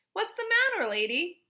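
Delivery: a high, loud gruff policeman; policeman's question. A narrator's reading. Policeman asking Missus Lambchop, high and loud. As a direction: call out like a pushy cop. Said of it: "the first policeman asked".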